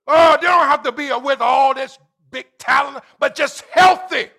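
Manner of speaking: loud, high, drawn-out preacher call; rising hall-filling sermon cry; preaching at full force. Acted.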